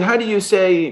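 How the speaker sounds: hint of a German accent, searching for English; mildly put-on German-accented English. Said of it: "he's struggling with his English".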